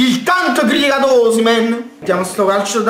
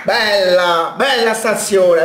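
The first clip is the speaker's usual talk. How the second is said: ordinary delivery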